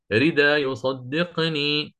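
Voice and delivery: ردا joined straight on to يصدقني, slowly; connected reading demo. Shown as a demonstration. Teacher shows students how to read it joined on. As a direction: read ردا يصدقني as one slow linked phrase. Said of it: "لو أراد أن يصل".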